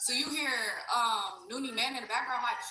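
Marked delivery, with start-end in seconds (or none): none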